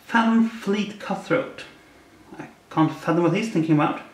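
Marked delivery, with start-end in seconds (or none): none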